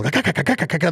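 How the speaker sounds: quick high clucking chuckle; feed chuckle. Retold or imitated. Buzzing on caffeine during Tim's podcast, high clucking chuckle. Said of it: "like a feed chuckle".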